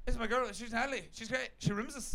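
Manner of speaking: lightly voiced fast Geordie; a hint of Geordie, slurred together; Geordie mumble. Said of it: "He once said to me".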